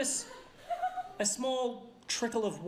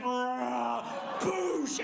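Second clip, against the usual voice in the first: imitated crashing bookshelf. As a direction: voice the slide and explosion. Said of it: "the bookshelf just went".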